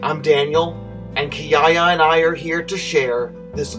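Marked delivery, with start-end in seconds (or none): none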